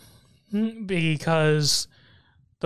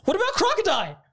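much higher, indignant fan protest; mock outrage. Real, not acted. Fan protesting that Crocodile was left off the visuals, high and indignant. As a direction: squeal it as a wronged fan, breaking into a laugh.

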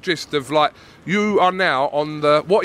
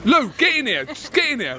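higher-pitched urging call; calling over; 7 semitones higher than usual. Real, not acted. Host calling Lou into the interview, urging. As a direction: beckon him over with raised voice.

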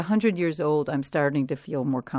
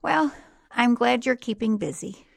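slow, higher-pitched mom voice; imitated unimpressed mother; 7 semitones higher, 4 dB louder than usual. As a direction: draw it out as mom's deflating reply. Mother's underwhelmed reply to good news.

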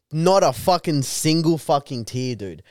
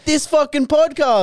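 high-pitched, louder mock protest; mock outburst; 12 semitones higher, 4 dB louder than usual. Co-host riffing as the aggrieved podcaster, mock outburst. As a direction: wail it high in mock despair.

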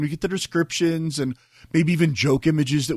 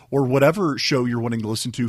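ordinary delivery

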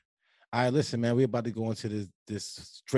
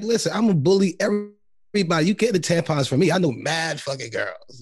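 hyped-up hustler; 7 semitones higher, 4 dB louder than usual. Retold or imitated. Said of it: "So I'm like".

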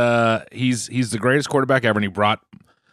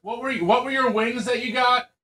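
high-pitched call through a closed door; yelling through a door; 11 semitones higher than usual. Real, not acted. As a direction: shout the question to the next room. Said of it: "[name] is yelling through the door".

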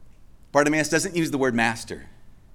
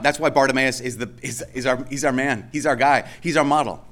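ordinary talk, nothing marked

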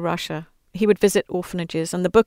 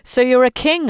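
mocking, louder sneer; jeering; 5 semitones higher, 6 dB louder than usual. Roman soldiers mocking Jesus, jeering. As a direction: taunt him with scorn. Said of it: "the Roman soldiers jeered".

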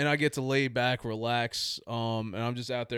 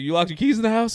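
shocked question; 9 semitones higher, 6 dB louder than usual. Retold or imitated. Mom's shocked question on phone.

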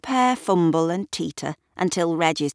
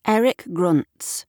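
ordinary delivery

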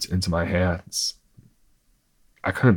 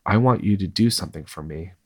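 ordinary delivery

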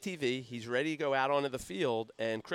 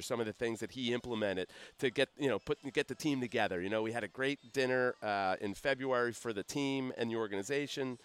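usual speaking voice

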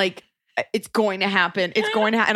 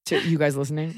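normal talking voice

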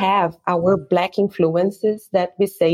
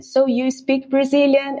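lightly voiced curious tourist, higher pitched; tourist's question; 8 semitones higher than usual. Tourists ask their guide about the language, lightly acted question.

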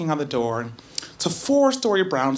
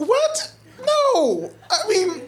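much higher, laughing shock; shocked disbelief; 14 semitones higher, much slower than usual. Retold or imitated. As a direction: recoil in stunned disbelief. Shocked by crack question.

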